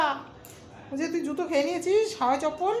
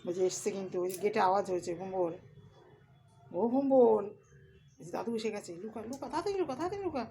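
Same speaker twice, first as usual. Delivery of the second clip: whispering while hiding; 4 semitones lower, 8 dB quieter than usual. Pretend. Urging pet to hide, grandpa arriving.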